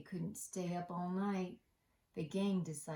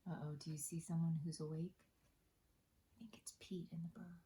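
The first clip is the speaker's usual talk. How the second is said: quick conspiratorial whisper; 7 dB quieter, faster than usual. Real, not acted. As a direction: whisper so you don't wake them. Aside to children, all asleep.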